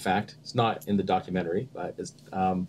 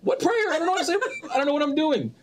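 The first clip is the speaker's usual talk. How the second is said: high, fast panicked protest; mock panic. Retold panicked reply.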